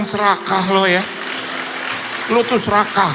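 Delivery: half-joking telling off; mock scolding. Pastor mock-scolding Michael for hogging the stage, loud teasing.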